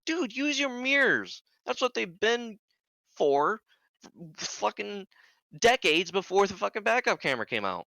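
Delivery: retold incredulous telling-off; exasperated scolding. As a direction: lecture him in disbelief, pitch way up. Scolding the driver for excuse.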